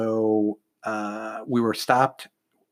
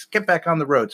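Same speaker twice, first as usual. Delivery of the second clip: higher-pitched insistence, re-enacted; retold insistent order; 7 semitones higher than usual. Officer overruling his worried driver, insistent retold order. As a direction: cut off the protest, mock command.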